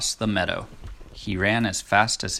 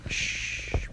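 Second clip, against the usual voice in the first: 1 hushing; 7 dB quieter than usual. Real, not acted. Hushing the child during reading.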